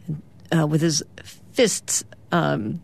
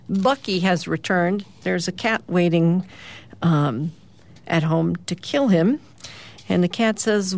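ordinary delivery, nothing marked

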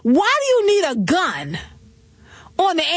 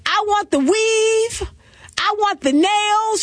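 slow, higher, each item savored; 7 semitones higher, slower than usual. Retold or imitated. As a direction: lay out the demands like you own them. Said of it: "this [name] actually said".

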